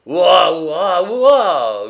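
a tipsy man suddenly howling at full lungs; drunken howl. Acted. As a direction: belt out a wordless drunken howl from the throat. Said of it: "hurla-t-il, soudain à pleine gorge".

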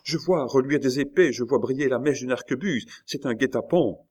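put-on high, frightened young woman's warning; alarmed cry. A narrator's reading. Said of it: "cria la jeune femme".

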